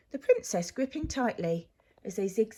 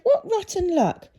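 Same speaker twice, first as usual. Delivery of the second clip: put-on higher and louder, eager little girl; a bright-eyed girl offering a sweet; girl's sympathetic voice; 7 semitones higher, 5 dB louder than usual. A narrator's reading. Girl sympathises with hoarse Zog, higher and louder girl voice. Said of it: "She said".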